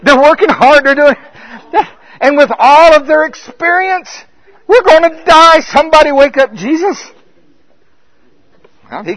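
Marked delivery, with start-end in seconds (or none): 0.0-7.1 loud high panicked rush; mounting alarm of exhausted sailors; frantic panic. Acted. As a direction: shout like the boat is sinking.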